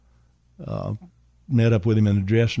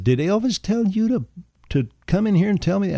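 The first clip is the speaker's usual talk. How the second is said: much higher, faster, sharp challenge; indignant; 10 semitones higher than usual. Retold or imitated. Storyteller retorting to the usher, high and indignant. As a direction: rise up offended at the kid.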